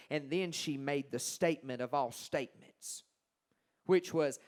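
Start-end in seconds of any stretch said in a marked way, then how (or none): none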